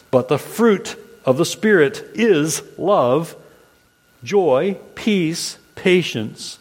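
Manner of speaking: slow, measured reading of a list of virtues; solemn scripture reading, word by word. Acted. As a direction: read each virtue out with a pause. Reads scripture aloud.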